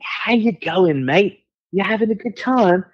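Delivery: high, drawn-out matey welcome; high, hearty British hello; friendly greeting. Retold or imitated. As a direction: imitate a chummy British rocker jostling a pal. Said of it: "friendly way".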